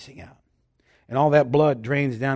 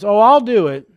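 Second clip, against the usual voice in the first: loud, high, grudging whine; reluctant whiny yes; 6 semitones higher, 9 dB louder than usual. Retold or imitated. A friend agreeing to help but not wanting to, grudging whine. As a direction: say it like you'd rather not. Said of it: "What's the tone of voice say".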